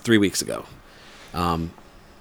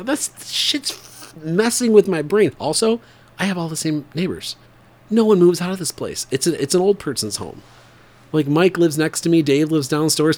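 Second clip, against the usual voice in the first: excited; 7 semitones higher than usual. Real, not acted. Freaked out by same neighbors.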